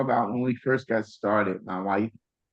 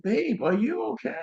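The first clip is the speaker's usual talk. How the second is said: worried call; 12 semitones higher than usual. Retold or imitated. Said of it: "I was like".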